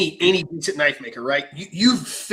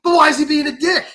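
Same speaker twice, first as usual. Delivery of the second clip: higher, louder indignant whine; whiny complaint; 10 semitones higher, 7 dB louder than usual. Retold or imitated. Knife maker griping about customer, whiny. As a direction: whine it like you're wronged.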